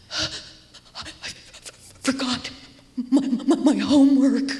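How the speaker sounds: put-on halting child's voice that breaks out loud on "homework"; tearful child voice. Girl telling her teacher she forgot homework, tearful.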